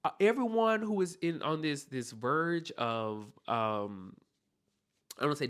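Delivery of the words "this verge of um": usual speaking voice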